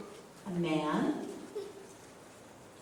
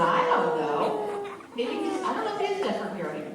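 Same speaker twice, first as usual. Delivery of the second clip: high-pitched baffled squeal with laughter; comic bafflement; 11 semitones higher, faster than usual. Acted. Daddy unsure if the baby is hearing, high comic bafflement. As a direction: squeal your doubt high, half laughing.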